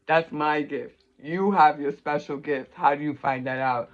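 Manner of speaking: ordinary talk, nothing marked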